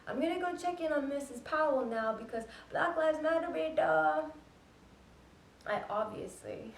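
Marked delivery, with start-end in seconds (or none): 0.1-4.3 high, loud imitation of an auntie announcing her errand; mock self-righteous neighbor. Imitating Missus Mukherjee leaving the doorstep, mock self-righteous. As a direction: parade the good deed in a raised voice. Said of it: "leaves the hypocrisy at the doorstep and says".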